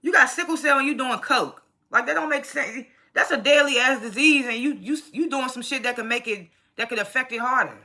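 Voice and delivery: lightly acted "are you serious" rant at the character; incredulous viewer scolding a TV character; exasperated disbelief. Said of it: "I'm just like".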